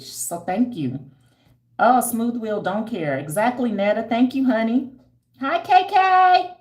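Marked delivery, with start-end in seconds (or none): 1.8-6.6 high, loud gushing hellos; excited greetings. Real, not acted. Vlogger thanks and greets viewers in chat, high and loud. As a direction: gush over each name in chat.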